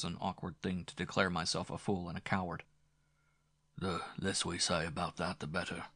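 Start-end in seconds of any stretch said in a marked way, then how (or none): 3.8-5.8 grave. A narrator's reading. Father gravely ending talk of the shooting, soft grave voice. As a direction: close the subject quietly and firmly. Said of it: "said her father gravely".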